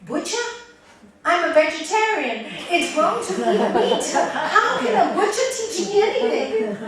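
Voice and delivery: loud, high scoffing, laughing through it; indignant disbelief. Acted. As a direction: scoff at the idea of a butcher teacher. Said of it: "this monk is thinking".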